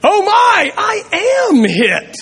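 hammed-up cry of pain; exclaimed with great emotion. Preacher voices the boy struck by a pebble, high dramatic yelp. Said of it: "exclaimed with great emotion".

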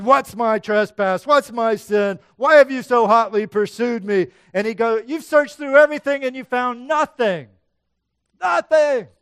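loud, high, furious protest; angry rebuke. Acted. Jacob rebuking his father-in-law Laban, loud and angry. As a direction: hurl the charges back at him. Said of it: "You can hear him, just mad".

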